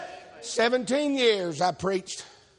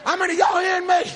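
preaching at full force; 6 semitones higher than usual. Real, not acted. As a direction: preach it to the back row.

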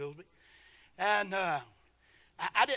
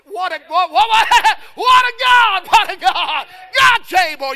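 high, loud, stretched cries of praise; shouted preaching climax; 10 semitones higher, 18 dB louder than usual. Real, not acted. Preacher shouting praise to the congregation about God. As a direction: cry it out with a laugh.

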